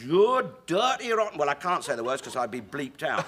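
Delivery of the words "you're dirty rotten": much higher, louder furious outburst; a man leaping up in rage; furious bloke. Retold or imitated. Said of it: "this bloke leapt to his feet and says".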